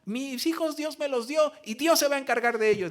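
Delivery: high, loud defiant cry; defiant shout. Retold or imitated. Mother defying her husband, high and loud. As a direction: shout it in faith. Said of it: "mamá diciéndole".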